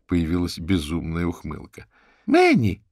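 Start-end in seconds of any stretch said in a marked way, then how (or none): 2.2-2.8 high sugary coo to the dog. A narrator's reading. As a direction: coo the dog's name sweetly. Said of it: "проворковала она". (воркующий зов собаке, высоко)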